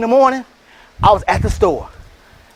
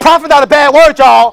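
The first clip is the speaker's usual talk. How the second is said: loud, raised declaration; shout; 3 semitones higher, 7 dB louder than usual. Real, not acted. To the audience, a loud ringing declaration. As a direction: hammer it home to the room. Hyping the crowd.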